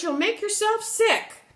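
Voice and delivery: high, loud worried plea; pleading cry. Acted. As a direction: beg her loudly, pitched high. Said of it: "cried George".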